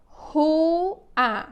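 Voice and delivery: RUA stretched into two slow syllables; drawn-out classroom dictation of rua. Shown as a demonstration. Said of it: "eu vou ditar algumas palavrinhas".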